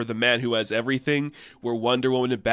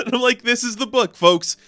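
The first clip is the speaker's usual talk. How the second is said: laughing, higher and louder; laughing glee; 12 semitones higher, 8 dB louder than usual. Real, not acted. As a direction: gush through a laugh about the book. Gleeful about the goofy comic.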